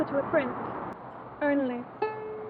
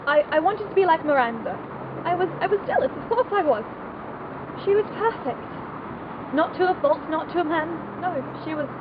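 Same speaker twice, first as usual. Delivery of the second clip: loud, raised, emotional outpouring; impassioned confession; 3 semitones higher, 5 dB louder than usual. Acted. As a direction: confess it fervently, voice lifted. Impassioned confession of jealousy and admiration.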